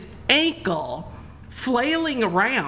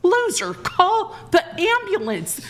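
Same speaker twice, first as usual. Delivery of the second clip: high, pleading yell with a laugh in it; desperate yell; 11 semitones higher than usual. Retold or imitated. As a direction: shout at him in desperate disbelief, slow. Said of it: "I'm going".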